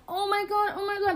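high, gushing squeal without extra volume; excited friends. Retold or imitated. Friends gushing over the red roses, high imitated squeal.

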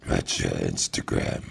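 very low creepy pickup line; sinister villain flirting; horror villain voice. Pretend.

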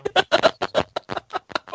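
high evil cackle; evil laugh. Real, not acted. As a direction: cackle like a villain. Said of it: "Olha até a risada maléfica".

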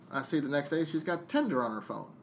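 ordinary delivery

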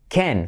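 "can" with an E vowel, like "Ken". Shown as a demonstration. Teacher giving the correct vowel, "can" like "Ken". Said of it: "como el novio de Barbie".

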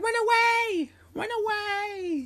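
very high, squealing shout; high squeaky yell. Retold or imitated. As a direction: squeal it like a panicked kid. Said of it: "He's like".

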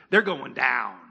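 low, dramatic voice of God pronouncing doom; God's voice. Retold or imitated. Preacher voicing God to the congregation, low and dramatic. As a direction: drop low and declare it like God. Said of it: "God says it this way".

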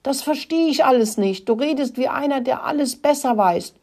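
high-pitched exasperated complaint; angry outburst. A narrator's reading. Rat Rudi rants at know-all Mokel, angry and high. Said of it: "brüllte Rudi los".

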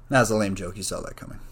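usual speaking voice

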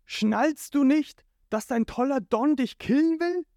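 high, insistent shout; exasperated yell. A narrator's reading. Sanji grabbing Jin's shoulder, exasperated yell. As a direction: shout sense into a friend. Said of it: "packt Jin an der Schulter und schreit".

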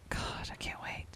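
breathy whispered aside; whispering. Real, not acted. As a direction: whisper it to yourself. Excited aside.

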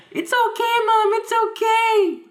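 put-on very high, slow little-boy voice; high child voice. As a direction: squeak it like a small hurt kid. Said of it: "Why are you imitating your own voice?".

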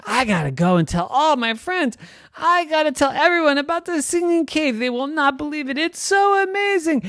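very high, fast, excited gush; bubbling over with excitement; thrilled. Acted. Girl who found a singing cave, excited gush.

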